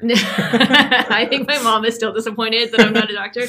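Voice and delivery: laughing through the words. Real, not acted. To the interviewer about her mom, laughing. Joking about mom's disappointment.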